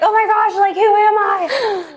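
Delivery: high, wailing who am I; hammed-up crisis cry; mock panic. Mock identity crisis quote.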